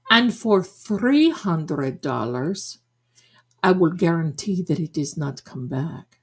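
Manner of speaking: measured, put-on Madame Blavatsky accent; mock psychic accent. Psychic boasting of her connections, deliberate fake accent. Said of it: "I have that Madame Blavatsky accent".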